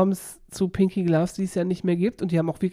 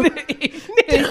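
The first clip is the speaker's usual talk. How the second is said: laughing while protesting; giggly high denial; 8 semitones higher, slower than usual. Real, not acted.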